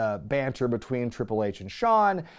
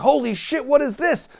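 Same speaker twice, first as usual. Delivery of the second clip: high, loud astonished; mock crowd shock; 11 semitones higher, 4 dB louder than usual. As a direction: gasp it out as the crowd. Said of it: "the crowd's like".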